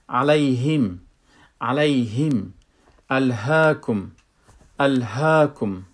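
loud, measured "علیهم" and "الهاکم" with air flowing on the h; airy ha. Shown as a demonstration. Teacher demonstrating letter ha, airy h in "علیهم" and "الهاکم". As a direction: release the breath through the h in "علیهم" and "الهاکم".

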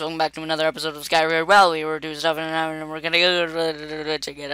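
rattled off very fast, tumbling into gibberish; failed auctioneer voice. Pretend. Host opening an episode, attempting an auctioneer voice. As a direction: race through it like an amateur auctioneer. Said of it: "I can't do the auctioneer voice".

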